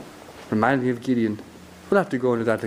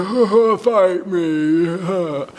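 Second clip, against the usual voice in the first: loud, hammed-up giant laugh and taunt; Goliath's taunting challenge; 4 semitones higher, 4 dB louder, much slower than usual. Storyteller as Goliath challenging the Israelites, loud mocking bluster. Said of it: "Goliath gives his challenge anyway".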